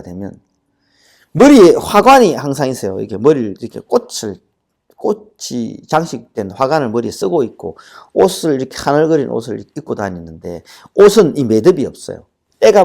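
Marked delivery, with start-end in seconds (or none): none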